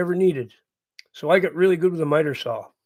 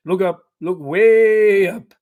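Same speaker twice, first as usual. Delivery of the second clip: louder, slow, drawn-out giant's call; Friendly Giant; 6 dB louder, slower than usual. Retold or imitated. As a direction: stretch out WAY like a storybook giant. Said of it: "Reminds me of the Friendly Giant".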